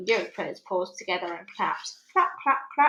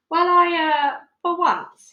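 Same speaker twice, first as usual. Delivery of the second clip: loud flustered stammering; lost for words; 8 semitones higher, 8 dB louder, slower than usual. Acted. The major, cornered by the girl, flustered stammer. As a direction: fumble for words, caught out. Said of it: "he seemed lost for words".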